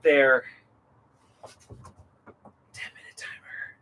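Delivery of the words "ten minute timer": whispering. Real, not acted. Whispered aside.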